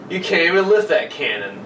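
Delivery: usual speaking voice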